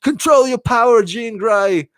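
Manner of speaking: high-pitched bad British accent slipping into Australian; James McAvoy's accent. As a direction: overdo a British accent until it sounds Australian. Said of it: "James McAvoy's accent".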